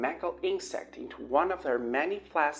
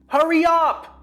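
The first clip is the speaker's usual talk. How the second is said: very loud high scream; 12 dB louder than usual. A narrator's reading. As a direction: scream at her to hurry. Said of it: "screamed Kyle again".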